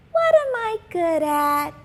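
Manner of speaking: put-on high, bright little-girl voice; hopeful schoolgirl wishing aloud; young girl Simone. A narrator's reading. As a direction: read it as a dreamy young girl.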